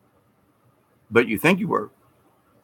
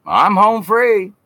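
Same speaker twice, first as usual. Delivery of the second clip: high, slow, smug sing-song; mock smug churchgoer; 11 semitones higher, 5 dB louder, slower than usual. Mocking Bible-toting churchgoers, smug and higher. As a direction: gloat like a smug believer.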